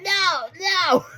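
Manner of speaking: high-pitched imitated cry of refusal; mock protest. Wrestler being put in the bag, mock panicked protest. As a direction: wail it high, hamming up the panic.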